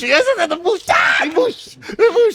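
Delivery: high shouted mockery of the bush; a hammed-up high yell. Mocking the bush line.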